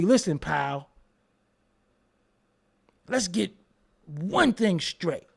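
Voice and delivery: slow deliberate tough-guy line; mock tough guy. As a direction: lay it down like a gangster.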